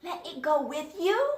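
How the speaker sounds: high, pleading character voice; pleading animal voice. Acted. Animal begging the others to take him along, high pleading voice. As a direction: beg to be taken along.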